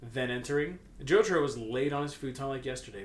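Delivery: normal talking voice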